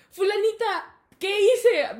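high, loud cheeky protest; acting out shameless innocence; brazen mock innocence. Caught boy to his teacher, brazenly innocent. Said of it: "Bien cínico".

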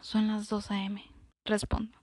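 plain reading voice

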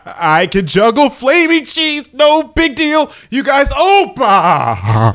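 slow build of mock ecstasy; hammed-up climax, drawing out the last words; faked orgasm. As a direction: act carried away, rising and stretching.

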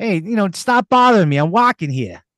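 high, irritated Boston pedestrian; mock-annoyed East Coast accent. Shown as a demonstration. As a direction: snap back in a put-on Boston accent. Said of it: "You are so good at that".

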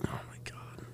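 hushed, breathless whisper; awed whisper after a scary twist. Real, not acted. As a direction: whisper it in real shock. Reacting to spooky ghost story.

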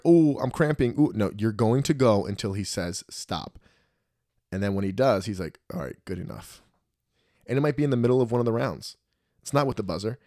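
ordinary delivery

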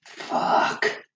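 soft, whispery swear under the breath; deflated curse. Retold or imitated. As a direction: let the curse out as a hushed breath. Retelling his reaction to broken mower.

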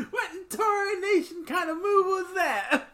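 put-on high, slow, laughing old-timer exclamation; mock old-fashioned grandma outrage; old lady heckler. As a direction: ham up a cranky old lady. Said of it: "grandma voice at hockey".

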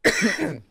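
loud coughing fit from spit; choking cough. Real, not acted. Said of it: "I'm fucking choking on my spit".

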